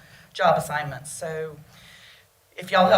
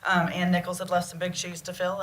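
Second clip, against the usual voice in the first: usual speaking voice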